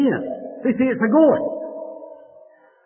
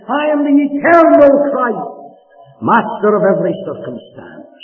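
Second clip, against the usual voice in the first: louder, rising climactic proclamation; full-force preaching; 6 semitones higher, 6 dB louder than usual. Acted. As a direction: proclaim it like a trumpet call. Climactic proclamation in Christ's words.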